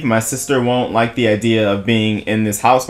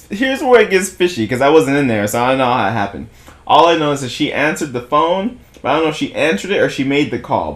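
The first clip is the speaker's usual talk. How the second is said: usual speaking voice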